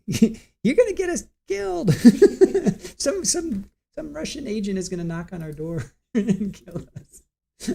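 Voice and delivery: high squeaky mock-terrified voice, laughing through it; mock panic. Telling viewers how she teased her husband, high mock fear. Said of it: "I would be like".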